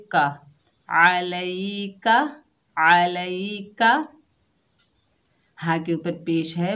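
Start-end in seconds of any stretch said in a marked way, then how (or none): 0.9-4.1 अलई का spoken very slowly, the ka short and unstretched; slow demonstration. Shown as a demonstration. Teacher recites अलई का slowly for students to repeat. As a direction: spell out अलई का slowly without stretching. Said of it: "बगैर खींचे पढ़ेंगे".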